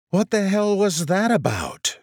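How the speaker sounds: high exasperated woman's voice; indignant woman. A narrator's reading. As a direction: voice a shocked diner. Woman's voice rose above others.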